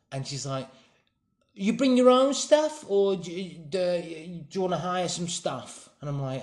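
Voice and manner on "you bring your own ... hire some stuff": put-on high-pitched London accent; London girl's accent. Girl offers a customer gear, high London accent. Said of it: "I'm not gonna do the accent".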